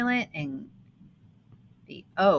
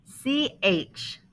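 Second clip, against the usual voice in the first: ordinary talk, nothing marked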